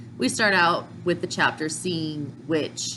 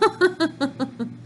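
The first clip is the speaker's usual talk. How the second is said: high laugh; 9 semitones higher than usual. Real, not acted. Laughs after the quote.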